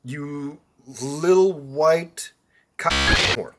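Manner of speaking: very slow, drawn-out sleazy read; mock slow reading. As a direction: linger on every word of the text. Reading creep's message aloud.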